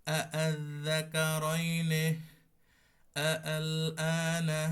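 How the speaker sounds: slow, loud recitation with the hamza of আল softened; softened tashil recitation. Shown as a demonstration. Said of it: "এটাতে আমরা ওই নরম করে পড়বো".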